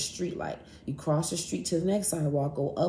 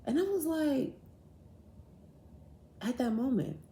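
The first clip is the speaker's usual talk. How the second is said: normal talking voice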